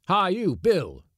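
high, loud hail; calling out sharply. Acted. Skipper calling Bill out of the galley, shouting. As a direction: holler at a crewman.

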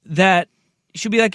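usual speaking voice

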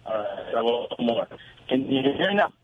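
ordinary talk

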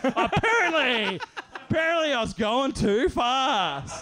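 high, loud, drawn-out sarcastic retort; hammed-up indignant admission; exasperated. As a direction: stretch each word in mock concession. Exasperated retort in retold argument.

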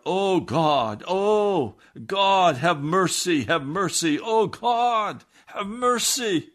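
high, loud crying out to God, slowing at the end; desperate cry. Retold or imitated. Jim in the wagon crying out to God for mercy, high and loud. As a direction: wail for mercy. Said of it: "he burst out".

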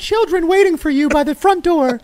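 very high, hammed-up wifely trill; sing-out like a doting vintage wife; old-movie housewife. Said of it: "And she's like".

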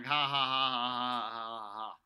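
slow, deliberate mock laugh; typed-out fake laugh. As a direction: read out "ha ha" as a drawn-out fake laugh, tailing down. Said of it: "And I write back saying".

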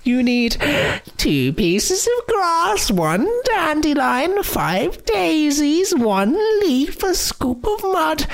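high, slow croaky witch voice; croaking witch. Acted. Witch speaks, same voice.

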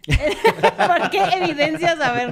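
laughing mock protest. Real, not acted. Co-host teasing colleague for singling out Berna, laughing mock protest. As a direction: protest slowly while cracking up.

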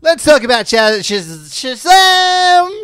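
high, loud hammed-up announcement ending on a drawn-out SHAZAM; with feeling. Co-host introducing the film with feeling, exclamatory. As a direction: build to a huge, over-the-top "Shazam!". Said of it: "You have to say it with feeling".

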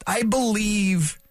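slow, drawn-out lightly voiced Jack Nicholson. Radio host riffing on his own voice to listeners, mock Nicholson drawl. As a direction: lean into a half-joking Nicholson-style drawl on I believe. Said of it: "So I sound like Jack Nicholson".

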